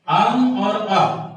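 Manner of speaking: slowly sounded out. Shown as a demonstration. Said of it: "teacher sounds out letters".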